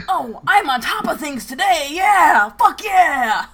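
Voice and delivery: building to a loud triumphant yell; excited cheer. Retold or imitated. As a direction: crow about being on top of things. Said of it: "'cause I'm like".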